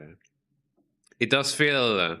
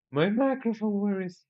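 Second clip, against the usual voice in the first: surprised; 11 semitones higher than usual. Real, not acted. Looking for missing microphone.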